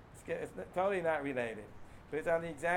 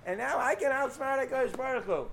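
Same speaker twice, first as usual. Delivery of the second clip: smug boast; 6 semitones higher, 3 dB louder than usual. Retold or imitated. Mocking the schemer's boast.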